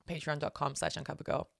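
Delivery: ordinary talk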